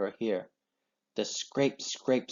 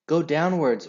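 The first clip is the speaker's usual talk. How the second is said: loud firm command; order; 5 semitones higher, 7 dB louder than usual. A narrator's reading. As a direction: bark the order down the tunnel. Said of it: "ordered Mister Fox".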